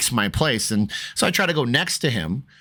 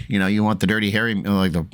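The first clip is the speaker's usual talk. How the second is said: usual speaking voice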